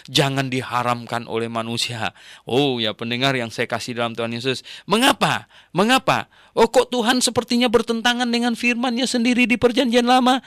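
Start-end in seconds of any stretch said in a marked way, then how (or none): 4.9-10.4 high, loud challenging question; preacher crying out a rhetorical why; rhetorical outcry. Real, not acted. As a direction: raise the voice to pose the puzzle. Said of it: "preacher's rhetorical challenge".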